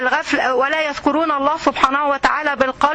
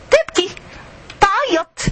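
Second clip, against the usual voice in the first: passionate outcry; 6 semitones higher than usual. Real, not acted. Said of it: "مستشعر الخيبة التقيلة".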